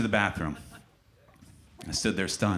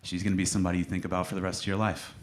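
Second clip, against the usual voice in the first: mock emotional; 3 semitones lower, 4 dB quieter than usual. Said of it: "I always get emotional at this part".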